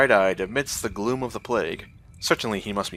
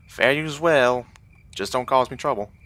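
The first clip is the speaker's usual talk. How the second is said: put-on Southern accent; 5 semitones higher, 5 dB louder than usual. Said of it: "for my southern accent".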